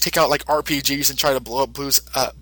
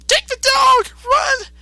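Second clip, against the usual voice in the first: Boo shouting; slower than usual. Retold or imitated. Boo warning Mister Satan to run, in a very high frantic shout. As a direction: yell at him to flee before you blow. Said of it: "Boo says".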